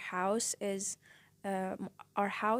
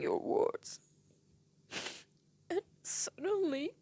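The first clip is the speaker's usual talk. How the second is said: crying; 8 semitones higher, 3 dB louder than usual. Real, not acted. Grieving her uncle's death.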